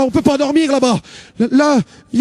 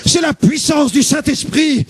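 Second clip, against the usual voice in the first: proclamation exaltée; 5 semitones higher, slower than usual. Retold or imitated. Annonce l'évangile comme une brute.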